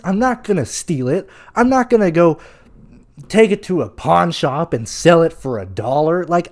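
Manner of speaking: ordinary talk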